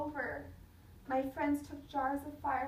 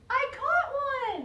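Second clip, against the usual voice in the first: loud, high, slow shout of victory; high loud call of pure triumph; triumphant shout; 12 semitones higher, 9 dB louder than usual. Acted. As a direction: shout it over everyone else. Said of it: "a voice called out above the others".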